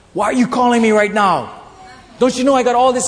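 high, loud exasperated wife on the phone; loud, frantic "why are you calling me"; exasperated yell. Retold or imitated. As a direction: snap at him like a stressed wife. Wife retold yelling on phone.

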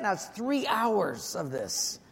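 normal talking voice

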